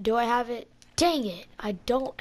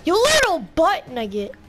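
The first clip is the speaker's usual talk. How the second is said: angry yell; 6 semitones higher, 9 dB louder than usual. Real, not acted. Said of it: "You're gonna make my stomach hurt".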